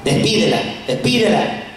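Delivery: low, muttered request; fed-up plea. Acted. Annoyed disciples asking the Lord to send her away, fed-up plea. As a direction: beg low to be rid of her. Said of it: "ya no puso gorro".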